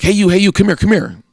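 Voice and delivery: loud, high barked call; officer shouting. Retold or imitated. Officer yelling at him across the lot, loud call. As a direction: yell it like a cop calling him over. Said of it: "And he's like".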